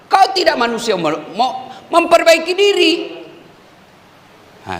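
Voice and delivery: ordinary delivery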